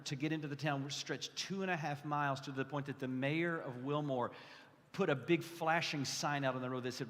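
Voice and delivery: normal talking voice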